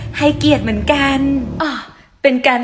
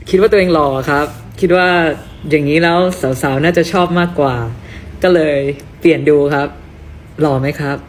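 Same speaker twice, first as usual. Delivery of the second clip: put-on much lower, cocky young man; macho guy voice; 11 semitones lower than usual. Guy with new haircut showing off in a deep voice. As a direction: drop the voice low and act handsome.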